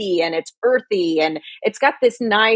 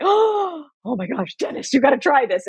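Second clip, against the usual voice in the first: mock amazement; 6 semitones higher than usual. Retelling her first sip to husband Dennis, mock amazed. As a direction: replay your own first-sip astonishment. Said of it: "took a sip and was like".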